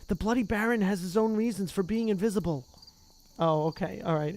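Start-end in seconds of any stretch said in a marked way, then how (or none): none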